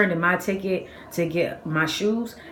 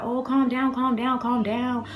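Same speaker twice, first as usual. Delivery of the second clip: higher, drawn-out calm-down chant; imitated calming onlookers; 7 semitones higher, slower than usual. Bystanders try to settle the angry skater, imitated soothing. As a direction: lightly mimic people trying to settle someone.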